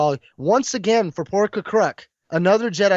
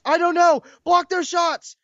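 much higher, urgent orders; urgent Jedi master; 11 semitones higher, 3 dB louder than usual. Retold or imitated. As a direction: snap out commands in a crisis. Jedi master voiced mid-battle.

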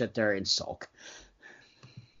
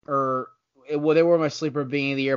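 ordinary delivery

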